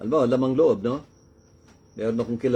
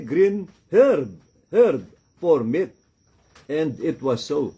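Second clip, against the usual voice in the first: solemn scripture reading; 6 semitones higher, 4 dB louder than usual. Acted. Reads the Genesis verse aloud. (mataas at mabagal na pagbasa ng talata)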